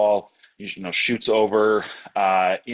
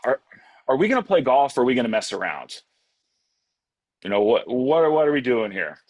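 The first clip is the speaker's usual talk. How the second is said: quick, fed-up, higher and louder; exasperated Moses; 5 semitones higher, 4 dB louder, faster than usual. Retold or imitated. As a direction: roll your eyes and demand an answer, fast. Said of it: "rolls his eyes and says".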